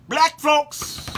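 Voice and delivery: high-pitched call to the crowd. Real, not acted. Calling out to the audience.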